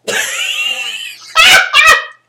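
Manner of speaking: loud high burst of laughter; real laughing fit; big laugh. Real, not acted. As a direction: crack up at the word.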